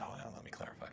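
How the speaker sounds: breathy unvoiced whisper; mock whispered reply. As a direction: drop to a whispered aside. [name]'s reply, voiced hushed.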